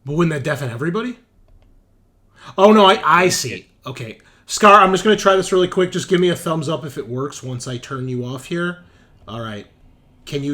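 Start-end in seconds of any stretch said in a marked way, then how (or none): none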